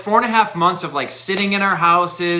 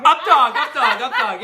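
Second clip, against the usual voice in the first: laughing; 6 semitones higher, 3 dB louder than usual. Real, not acted. Repeating punchline, laughing.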